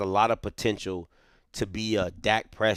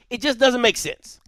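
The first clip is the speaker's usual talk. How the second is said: pitched much higher and louder; emphatic outburst; 12 semitones higher, 4 dB louder than usual. Real, not acted. Host exasperated at how Southern builds its team, emphatic outburst. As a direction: hammer the point home, exasperated. Repeat jumps up high.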